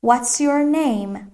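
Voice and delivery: very slow, drawn-out English question; careful teacher's stretched-out demonstration; slow model question. Shown as a demonstration. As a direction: model the question slowly for learners.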